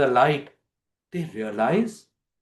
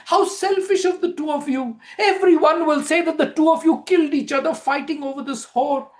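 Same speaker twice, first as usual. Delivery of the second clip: high, loud frantic outburst; despairing wife; 10 semitones higher, 4 dB louder than usual. Acted. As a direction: rail at the two dead men.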